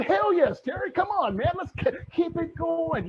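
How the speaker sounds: high-pitched, eager mock cheering-on; excited audience reply. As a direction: play a fired-up fan urging him on.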